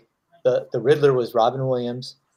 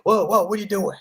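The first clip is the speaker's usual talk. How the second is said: Al Pacino alarmed; 8 semitones higher than usual. Retold or imitated. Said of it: "Al Pacino was like".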